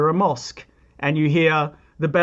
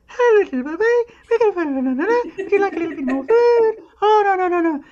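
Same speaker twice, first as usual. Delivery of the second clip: put-on high sing-song baby talk, much higher; motherese; 4 dB louder than usual. Shown as a demonstration. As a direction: coo it like a mother to her infant. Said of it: "what they call motherese".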